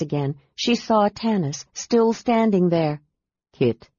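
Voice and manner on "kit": a lower, gentle man's voice; gently. Acted. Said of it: "he said gently".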